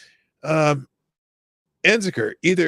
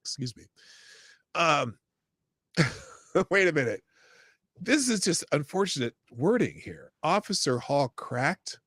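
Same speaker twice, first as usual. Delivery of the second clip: ordinary delivery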